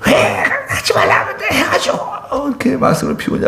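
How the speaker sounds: very high, breathy imitation of the pastor yelling; mock yell. Imitating the senior pastor scolding, very high mock yell. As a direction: squeak out the old pastor's rebuke. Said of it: "그러고 막 소리 지르십니다".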